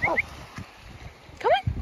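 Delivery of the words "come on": very high coaxing call; squeaky calling a dog. Real, not acted.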